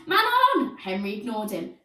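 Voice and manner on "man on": very high, loud warning; high, loud urgent shout; urgent call. A narrator's reading. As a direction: shout the warning across the pitch. Teammate calling a warning.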